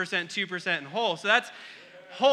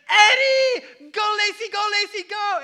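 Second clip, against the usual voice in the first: put-on much higher, much louder child's shout chanting "go Lacy"; toddler yelling; 12 semitones higher, 12 dB louder than usual. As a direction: scream it at the stranger like a thrilled kid. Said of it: "looked at this guy and said".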